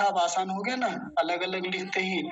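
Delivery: normal talking voice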